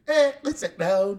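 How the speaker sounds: put-on Vietnamese accent. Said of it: "Vietnamese accent".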